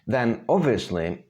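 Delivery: normal talking voice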